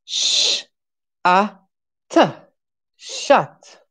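"Sh, a, t, shut" sounded out, "shut" with a short open a; sounding out. Shown as a demonstration. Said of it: "How we pronounce the U".

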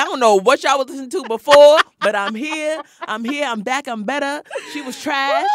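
loud, brash, fast bragging; cocky guy imitation. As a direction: strut it like a cocky host. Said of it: "He's like".

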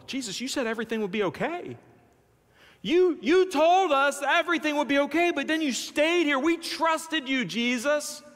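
high-pitched, bewildered protest; befuddled disciples. Acted. Disciples confronting Jesus after Lazarus died, in high befuddled protest. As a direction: protest as if let down by someone trusted. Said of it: "these disciples are befuddled".

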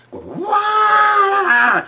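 high, loud trumpeting roar of an elephant; elephant trumpeting scream. Retold or imitated. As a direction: blast it out like a raging elephant. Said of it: "And the elephant screamed too".

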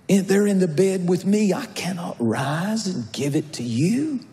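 fervent pulpit preaching. Acted. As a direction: preach it out to the congregation. Preaching to congregation.

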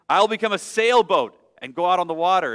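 put-on the runaway child daring his mother; defiant bunny. Bunny voice in story.

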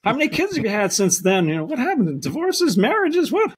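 high-pitched rapid-fire mock interrogation; excited catching-up. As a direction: pepper an old friend with eager questions. Acted catch-up with old friends.

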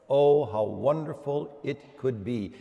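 ordinary talk

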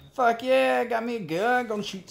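high, loud gleeful cheer; excited whoop. Real, not acted. Gamer finding a shotgun, loud excited cheer. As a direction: crow with joy over the new weapon. Grabs shotgun in horror game.

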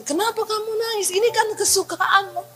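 a light high husband voice, puzzled. Acted. (suami bertanya heran, suara agak tinggi)

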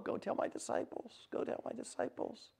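whispered, very soft; hushed instruction. Retold or imitated. Jesus sending the women to his disciples, whispering. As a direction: drop to an intimate whisper.

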